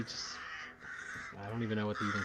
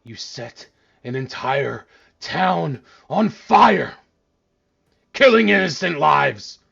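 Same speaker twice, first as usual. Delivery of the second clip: slow, booming divine fury, climbing higher; a betrayed deity thundering accusations; wrathful god; 6 semitones higher, 14 dB louder, much slower than usual. Acted.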